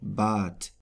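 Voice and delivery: Bad ending in a crisp T sound; final D as T. Shown as a demonstration. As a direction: say German Bad with the D hardened to T. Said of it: "it is pronounced like a T".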